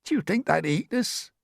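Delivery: high, anxious question; fearful earthworm. A narrator's reading. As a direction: ask it in a timid high voice. Frightened earthworm asks.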